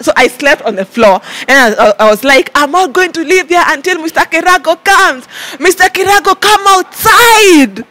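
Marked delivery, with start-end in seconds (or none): 2.5-7.8 calling out. Retold or imitated. Calling for the boss.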